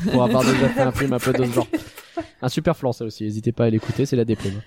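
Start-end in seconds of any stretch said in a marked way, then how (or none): none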